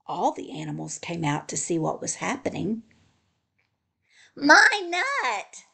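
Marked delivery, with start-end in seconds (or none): 4.4-5.6 high, loud storybook shout; possessive shout. A narrator's reading. Pecan claims the nut from Hickory, high shout. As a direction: shout to claim what is yours. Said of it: "Pecan shouted".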